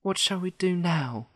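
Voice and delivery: put-on high, plaintive girl's voice, mildly sad. A narrator's reading. Dorothy asks her friends for help, sadly. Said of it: "asked Dorothy sadly".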